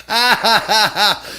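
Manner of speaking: high, loud fake ha-ha laugh; forced mock laugh. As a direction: laugh it off loudly and nervously. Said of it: "And I went".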